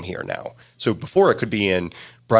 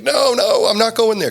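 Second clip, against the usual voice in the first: high, louder "no, no" of a refusing cabbie; cab driver refusing; 13 semitones higher, 3 dB louder than usual. Retold or imitated. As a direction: brush them off like a cab driver turning down the fare. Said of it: "the cab driver's like".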